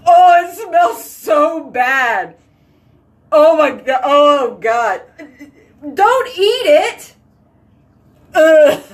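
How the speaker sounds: gagging, high and loud disgust; reacting to a horrible stench; disgusted by smell. Real, not acted. Dog poop smell in bathroom.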